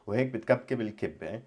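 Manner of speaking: put-on quick, light Lebanese accent on "وهيك بتكبكب الكبة". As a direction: read it the way the Lebanese lady says it. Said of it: "Actually, I did pronounce it in the way she did".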